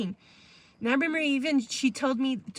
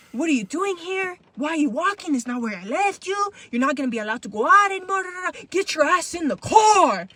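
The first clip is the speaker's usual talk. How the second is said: mom screaming; 4 semitones higher, 5 dB louder than usual. Retold or imitated. Mom ordering her kid into the van, yelling. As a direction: build the mom's rant to a shout. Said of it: "she's like screaming at me".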